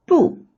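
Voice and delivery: "Bu" starting high and dropping quickly; falling tone. Shown as a demonstration. Teacher gives the word's original tone, high falling bu. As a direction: drop "Bu" sharply from high. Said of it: "originally has a falling tone".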